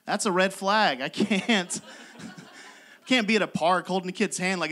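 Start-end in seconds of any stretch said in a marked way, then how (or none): none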